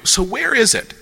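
high-pitched innocent question; eager questioner. Acted. Opening a philosophical discussion, high curious question. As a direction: ask it high, as if just starting out. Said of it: "you start here and you go".

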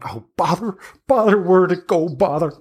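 put-on high, cross old hag voice; a crone fussing after a fall; mildly irritated old woman; flustered hag. Hag searching her backpack.

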